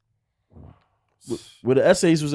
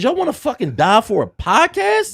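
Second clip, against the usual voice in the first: high, louder exasperated scold; scolding cop; 11 semitones higher, 7 dB louder than usual. Retold or imitated. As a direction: scold him like a fed-up cop. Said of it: "He said".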